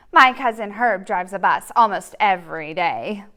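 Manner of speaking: loud, whiny pigeon begging; the pigeon begs and pesters the reader; pleading pigeon. Acted. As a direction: wheedle like a pushy little bird.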